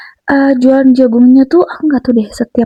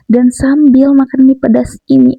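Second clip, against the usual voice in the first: ordinary talk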